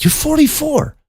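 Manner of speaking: very high, squeaked question; shocked disbelief. Retold or imitated. Teller re-enacts hearing her age, shocked. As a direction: echo it back with the pitch shooting up.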